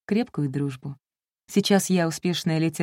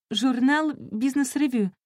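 improvised lie; 6 semitones higher, slower than usual. A narrator's reading. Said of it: "сообразила солгать я".

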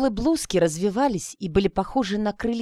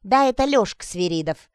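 lightly voiced know-all granny; knowing old-lady bystander, lightly acted; 4 dB louder than usual. A narrator's reading. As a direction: explain it like the neighbourhood gossip, a bit louder. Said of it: "объясняла какая-то всезнающая бабушка".

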